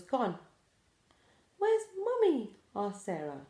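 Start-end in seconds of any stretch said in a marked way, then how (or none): 1.6-2.6 put-on high worried baby owl; small anxious owlet voice, high. A narrator's reading. Sarah owl asking where her mother went, high and worried.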